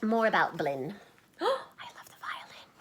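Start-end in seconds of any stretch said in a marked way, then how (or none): 1.2-2.7 breathless whispered delight; awed hush. Acted. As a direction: whisper it like a secret treasure.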